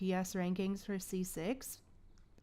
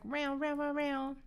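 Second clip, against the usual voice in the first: high, lightly voiced cat meow; cat imitation; 5 semitones higher than usual. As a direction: mimic the cat's meowing. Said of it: "He came in and was like".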